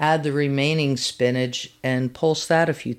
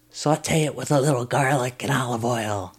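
lightly voiced gravelly old grandma; a hint of a gravelly farm grandma. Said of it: "she said in her grandma gravelly voice".

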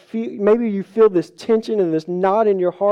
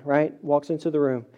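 normal talking voice

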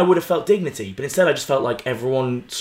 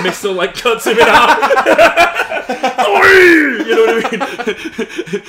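laughing; 9 semitones higher, 8 dB louder, much slower than usual. Real, not acted. Laughing hard at own story.

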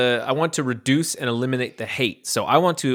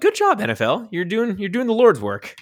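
high mock-cheerful praise; sarcastic praise; 10 semitones higher, 4 dB louder than usual. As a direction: congratulate them with heavy sarcasm. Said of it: "I'm like".

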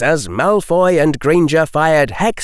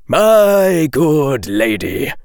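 slow, grave professor voice; 2 semitones higher, much slower than usual. A narrator's reading. Quirrell correcting Lady Greengrass, slow and grave. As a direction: weigh every word gravely. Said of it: "sounding grave".

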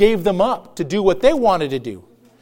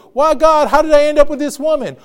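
higher-pitched protest to God; mock lament; 5 semitones higher, 3 dB louder than usual. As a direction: act out an exasperated plea to heaven. Acting out complaints to God.

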